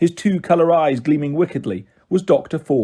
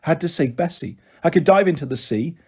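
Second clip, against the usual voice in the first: ordinary delivery, nothing marked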